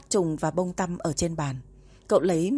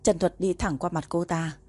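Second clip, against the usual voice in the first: plain reading voice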